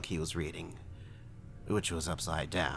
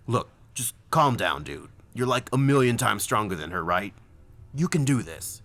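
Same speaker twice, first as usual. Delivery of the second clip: loud, higher buddy voice; urgent pep talk; 4 semitones higher, 6 dB louder than usual. Acted. Zach talking down his panicking friend, loud and urgent. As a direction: push a panicking friend to steady up, forcefully.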